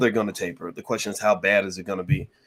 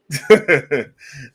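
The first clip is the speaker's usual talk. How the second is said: laugh; 6 semitones higher, 9 dB louder than usual. Real, not acted. Laughing at own answer.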